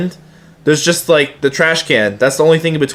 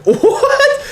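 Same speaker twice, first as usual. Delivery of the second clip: high, squeaky "what?" through a laugh; shocked laughing yelp; laughing disbelief. Retold or imitated. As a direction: blurt it out, cracking up. Stunned by sub's comment.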